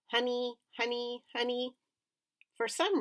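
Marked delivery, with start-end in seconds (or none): 0.1-1.8 higher squawky "honey" repeats; parrot voice. A narrator's reading. Pet bird copying the family. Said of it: "Uncle Feather started".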